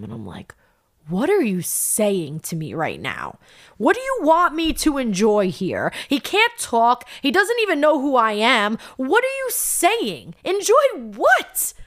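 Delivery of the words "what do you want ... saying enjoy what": rising, louder, higher disbelief; retold angry outburst. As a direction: fling the questions back at the doctors, building in anger. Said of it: "I was so mad".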